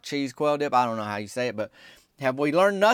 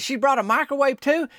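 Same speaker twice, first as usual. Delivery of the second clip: much higher, disbelieving retold question; incredulous question; 11 semitones higher, 4 dB louder than usual. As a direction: act out being taken aback. Retold shocked question to Myron.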